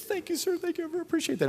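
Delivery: high-pitched overjoyed gratitude; gushing thanks. Retold or imitated. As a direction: gush like a starstruck kid who just got in. Grateful kid thanks bouncer.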